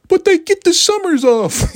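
very high mock-complaint voice; exaggerated high-pitched mimicry; mocking whiny objector. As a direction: parody a critic in a squeaky high pitch. Mocks critics of teachers.

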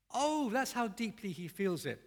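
normal talking voice

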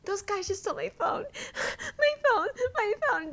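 high-pitched mock sobbing whine; imitated distraught wail, breaking into sobs; Shay. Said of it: "we see Shay, and she's like".

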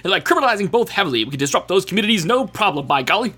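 fast, clipped Nixon-style announcer; lightly voiced Nixon enunciation; old school announcer. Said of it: "an old school announcer, like Nixon's, enunciation about it".

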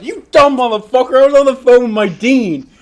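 high, loud yell at a friend; re-enacted furious scolding. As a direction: chew out your friend at full volume. Retells scolding friend after call.

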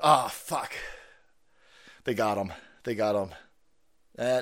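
ordinary talk

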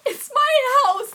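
high, loud, slow desperate wail; bawling protest. Retold or imitated. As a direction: plead with the neighbours through tears. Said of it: "I'm on my porch bawling".

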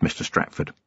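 breathy low threat, barely voiced; whispered Mister Stratford; menacing whisper. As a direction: hiss his name like a warning. Vimes menacing a captured killer.

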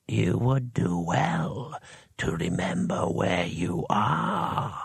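low, slow, drawn-out hissing voice; dry hiss. A narrator's reading. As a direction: hiss it slowly, dry as tinder. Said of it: "Another voice, dry as tinder, hissed".

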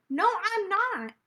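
slow, high, drawn-out protest; indignant denial. Retold or imitated. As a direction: stretch it out, offended. Arguing back at adults.

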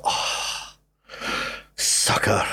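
voiceless, breathed-out disdain; scornful sigh. Retold or imitated. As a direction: sigh it under your breath as a private thought. Retold private scorn at friend's invitation.

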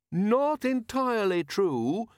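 high, slow, tactful correction; diplomatic. A narrator's reading. Leonard tactfully corrects the watchmen about metal, diplomatic. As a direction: gently contradict in a drawn-out high voice. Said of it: "said Leonard diplomatically".

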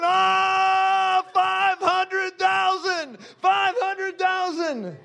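high, very slow crying shout; shouted vow. Retold or imitated. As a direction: wail it out overcome. Sobbing shouted vow on floor.

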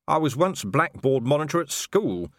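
indignant, pointed boast; sharp retort. A narrator's reading. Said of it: "said Vimes sharply".

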